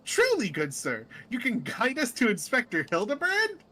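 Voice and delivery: lightly voiced eager character; hopeful adventurer. Nashu asks hopefully.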